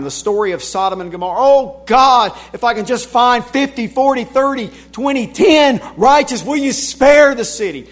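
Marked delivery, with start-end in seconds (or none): 1.4-7.9 louder, higher pleading bargain with God; pleading intercessor; voicing Abraham. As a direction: beg God as Abraham, raising the stakes. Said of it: "How about Abraham".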